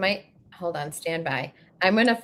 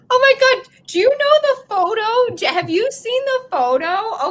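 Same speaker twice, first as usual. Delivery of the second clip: laughing excitement; 12 semitones higher, 5 dB louder than usual. Real, not acted. Reacting to song joke.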